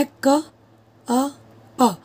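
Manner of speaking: "क, अ, प" broken into separate sounds; अलग-अलग ध्वनियाँ. Shown as a demonstration. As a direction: say "क", "अ", "प" one by one, pausing between. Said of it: "एक वर्ड को हम ब्रेक करेंगे". ("क, अ, प" को तोड़कर, रुक-रुककर बोलें)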